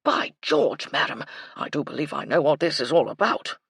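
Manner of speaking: put-on old officer struck by an idea; blustery colonel. A narrator's reading. Colonel sure he has guessed the secret, blustery. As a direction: announce it with military bluster.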